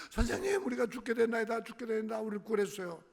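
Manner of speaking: a high, frightened cry for help in the storm; panicked plea. Acted. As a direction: Peter calling to his teacher in terror. Peter cries out in storm.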